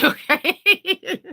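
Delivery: high laughing okay. Real, not acted. Laughing to friends, don't judge me, laughing.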